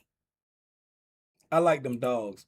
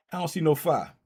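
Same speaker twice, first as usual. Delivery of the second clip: ordinary talk, nothing marked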